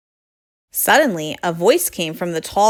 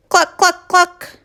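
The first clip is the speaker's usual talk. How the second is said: high, loud, short clucks; hen clucking; 11 semitones higher, 6 dB louder than usual. Acted. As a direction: cluck away like a happy hen. Pig clucks happily like a hen.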